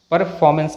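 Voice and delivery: परफॉर्मेंस with an r before f; sounded r. Shown as a demonstration. Teacher calls this form wrong, r sounded. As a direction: pronounce the first r in परफॉर्मेंस. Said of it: "कहना गलत है".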